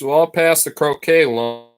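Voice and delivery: ordinary delivery, nothing marked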